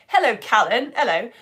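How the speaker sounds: ordinary talk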